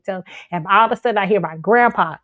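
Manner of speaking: ordinary talk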